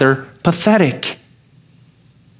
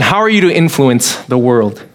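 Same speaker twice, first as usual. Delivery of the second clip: ordinary delivery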